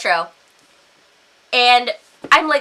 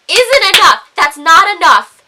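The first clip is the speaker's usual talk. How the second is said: louder, higher, indignant protest; exasperated rant; 3 semitones higher, 8 dB louder than usual. Real, not acted. Woman ranting about refusals being ignored, exasperated. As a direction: insist angrily, punching it out.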